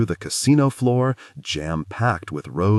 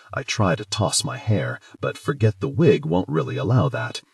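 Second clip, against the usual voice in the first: ordinary delivery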